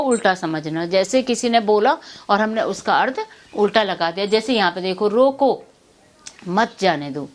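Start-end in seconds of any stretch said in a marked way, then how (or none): 5.1-7.3 रोको, long pause, then मत जाने दो lower; रोको as one command, मत जाने दो as another; pause after रोको. Shown as a demonstration. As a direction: break firmly after रोको, keeping मत with जाने दो. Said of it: "इन शब्दों को उल्टा बोल दिया".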